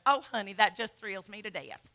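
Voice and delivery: soft, sweet Southern mom imitation; cute Southern mother. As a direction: quietly mimic a doting Southern mother. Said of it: "so cute and Southern".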